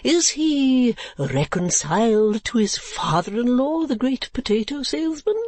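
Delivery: high, slow, rising question; much interest. Acted. Old gentleman asking after imaginary royalty, keenly interested. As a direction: eccentric old man, eagerly inquiring. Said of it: "said the old gentleman with much interest".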